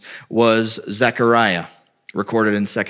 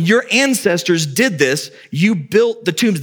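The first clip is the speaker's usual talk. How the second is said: accusing prophet; 6 semitones higher than usual. Retold or imitated. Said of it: "you've got them going".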